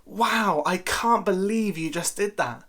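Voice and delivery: high, bright amazed delivery; admiring exclamation, lifted pitch; impressed wow. Shown as a demonstration. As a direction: sound genuinely impressed. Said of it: "notice the difference in emphasis".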